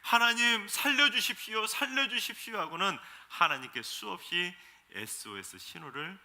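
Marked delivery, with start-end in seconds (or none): 0.0-2.6 높고 크게 다급하게 애원; 살려주십시오를 크게 외치며 매달리듯; 살려달라는 부르짖음. Retold or imitated. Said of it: "그때서야 하나님께". (멸망 직전 하나님께 매달리는 백성의 큰 외침)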